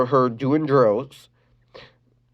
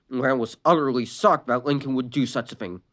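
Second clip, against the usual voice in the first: ordinary delivery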